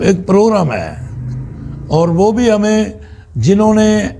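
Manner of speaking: ordinary talk, nothing marked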